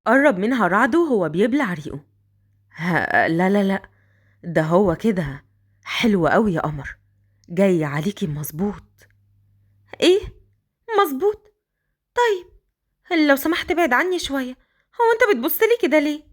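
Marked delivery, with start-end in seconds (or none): 2.8-8.8 lower, slower man's voice; a man flattering, low and slow; Raad, low man's voice. Acted. Raad praising the girl in the shirt, lower slower voice. 10.0-16.2 put-on higher, young girl's voice; Qadr, high girlish voice. The girl complaining to Raad his clothes don't fit, high girlish voice.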